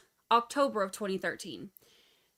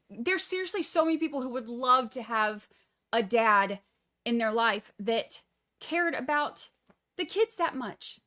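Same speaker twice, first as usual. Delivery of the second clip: usual speaking voice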